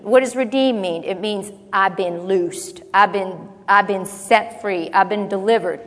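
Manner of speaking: ordinary talk, nothing marked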